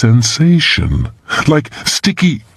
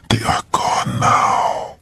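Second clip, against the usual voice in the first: breathy whisper into his ear; 6 dB quieter, slower than usual. A narrator's reading. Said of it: "whispering in his ear".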